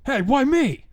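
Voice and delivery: high indignant shout. A narrator's reading. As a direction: shout it, outraged. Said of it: "This led to him shouting".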